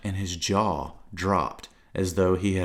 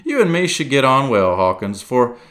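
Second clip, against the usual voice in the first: loud, higher merry sea cook; jovial pirate; 5 semitones higher, 6 dB louder than usual. Acted. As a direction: chuckle along like an old pirate. Silver role.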